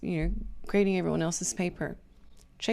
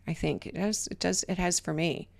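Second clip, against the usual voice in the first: ordinary delivery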